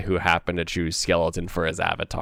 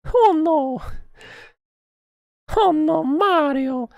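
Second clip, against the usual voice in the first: much higher, nasal-placed Mario impression; 3 dB louder, slower than usual. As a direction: squeak it out like the plumber, slowing on the name. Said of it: "couldn't do Mario voice".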